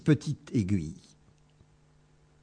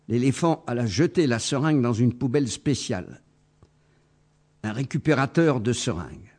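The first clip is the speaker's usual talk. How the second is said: usual speaking voice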